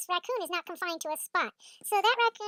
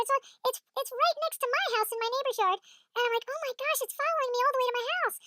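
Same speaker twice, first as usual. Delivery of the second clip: higher, agitated retelling; alarmed exclaiming; 6 semitones higher than usual. Retold or imitated. Raccoon followed her home, higher alarmed exclaiming.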